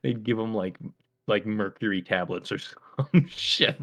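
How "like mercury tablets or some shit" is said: normal talking voice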